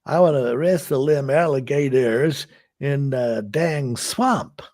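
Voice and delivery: a hint of swamp hillbilly. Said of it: "do a male accent".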